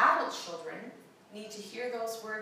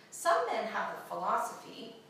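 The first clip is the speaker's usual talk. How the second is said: ordinary delivery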